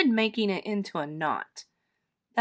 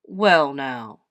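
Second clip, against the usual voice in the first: low, loud grown-up bear; low, weighty reply; Mister Bear; 5 semitones lower, 6 dB louder than usual. A narrator's reading. Mister Bear answers apology.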